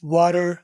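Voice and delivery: "Water" with a tapped R for the T; T turned to R. Shown as a demonstration. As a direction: say "Water" like "wa-rer". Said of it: "T को आर कर दिया".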